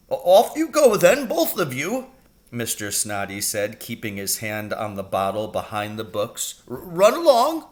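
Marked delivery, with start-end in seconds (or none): 0.1-2.1 high, stumbling, nervous headmaster; flustered stammer. Acted. Headmaster hiding the gin bottle from two boys, stammering high. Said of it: "He jumped a mile when he saw us". 6.7-7.7 high, stumbling, nervous headmaster; flustered stammer. Acted. Headmaster hiding the gin bottle from two boys, stammering high. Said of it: "He jumped a mile when he saw us".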